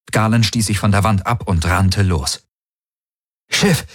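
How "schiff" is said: high, urgent call. Acted. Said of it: "rief er". (dringender Ruf)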